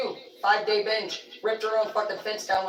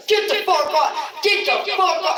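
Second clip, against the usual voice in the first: loud, high command, shouted; acted furious holler; re-enacted yelling; 11 semitones higher, 7 dB louder than usual. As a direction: yell it like rousing someone by force.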